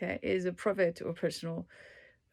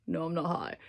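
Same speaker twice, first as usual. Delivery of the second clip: usual speaking voice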